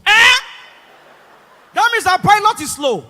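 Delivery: high, shouted indignation; outraged passenger. Retold or imitated. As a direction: yell in comic disbelief at the pilot. Passenger outraged at being overtaken.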